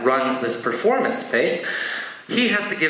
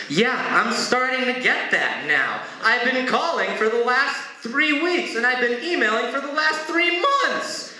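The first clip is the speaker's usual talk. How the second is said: high-pitched exasperated complaint, climbing; acted fed-up outburst, voice rising as it goes; pissed; 11 semitones higher than usual. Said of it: "this time he's a little pissed".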